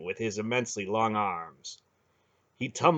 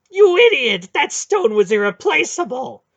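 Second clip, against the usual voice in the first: high, loud furious shout; 13 dB louder than usual. Acted. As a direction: scream at him in outrage. Hierarch raging over broken stone.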